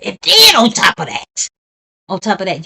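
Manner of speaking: high, loud rising indignation; angry shout. Real, not acted. Streamer ranting at the killer through the screen, loud and angry. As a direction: hit it hard in outrage.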